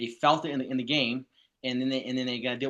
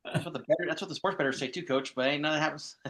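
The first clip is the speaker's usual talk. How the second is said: ordinary talk, nothing marked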